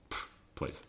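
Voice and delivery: breathless whispered dismissal; whispered scoff. Real, not acted. Host dismissing the excuse-maker, whispered scoff. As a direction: scoff it under your breath.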